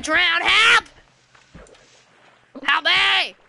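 high, loud panicked shout; cries for help. Pretend. Player is about to drown in game, shouting for help. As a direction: build from alarm into a yell.

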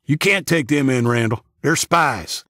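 worked-up accusing hillbilly; agitated protest. A narrator's reading. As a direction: protest hotly to the leader. Bearded hillbilly protests to leader.

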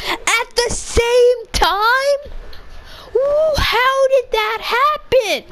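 high, slow astonished voice; drawn-out disbelief; amazed. Real, not acted. Surprised both died.

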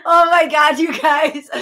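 loud, high exclamation; embarrassed outburst. Real, not acted. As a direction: burst out, flustered.

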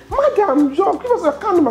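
half-mocking the company caller asking politely; imitating the caller.

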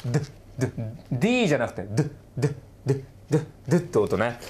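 ドゥ said as a voiced d sound; d sound demo. Shown as a demonstration. Teacher showing students the ending sound, clear voiced d.

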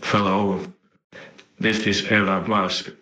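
normal talking voice

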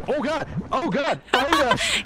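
high, startled cries of alarm; panicked. Real, not acted. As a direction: yelp in sudden panic. Sinking into quicksand.

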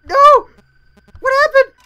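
high, loud, crying panic; panicked screaming. Real, not acted. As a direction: shriek in confusion.

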